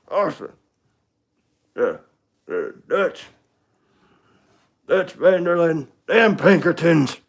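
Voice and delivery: an outlaw cursing the detectives on his trail; Arthur Morgan impression. Shown as a demonstration. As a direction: curse the lawmen at full voice. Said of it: "I can try an Arthur Morgan".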